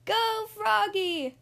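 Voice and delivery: high, drawn-out cheer; hollering. Acted. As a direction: holler it from the stands. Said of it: "hollered Froggalina".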